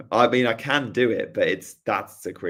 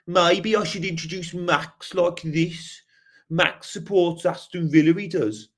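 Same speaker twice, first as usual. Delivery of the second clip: slow, higher Brummie voice; Birmingham accent; 7 semitones higher, slower than usual. Shown as a demonstration. Streamer introducing Max to viewers, Birmingham accent. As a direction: put on a drawn-out Brummie accent. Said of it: "I can do Birmingham".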